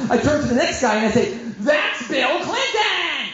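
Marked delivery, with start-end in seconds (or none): 1.6-3.3 high, drawn-out starstruck squeal; hammed-up excited cry; all excited. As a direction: stretch it out like a giddy fan. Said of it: "I was, like, all excited".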